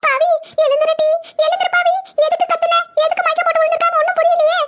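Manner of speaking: high, urgent wailing pleas; acted alarm, pitched high; distraught mother. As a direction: try frantically to rouse her. Mother finds daughter fainted.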